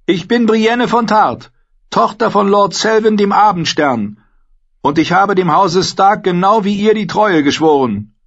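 high, loud earnest declaration; proud young woman. A narrator's reading. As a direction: stand tall and claim your oath. Narrator voices Brienne.

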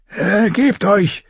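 slow weak croaking; hoarse croak. A narrator's reading. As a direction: croak it, throat nearly shut. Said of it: "krächzte er schwach".

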